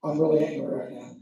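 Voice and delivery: low, deadpan imitation of anger; understated anger. Man reporting trouble to the pastor, understated anger. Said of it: "he can be angry and be like".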